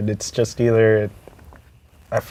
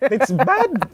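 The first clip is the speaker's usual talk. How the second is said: put-on Filipino accent; 12 semitones higher than usual. Mom voiced scolding.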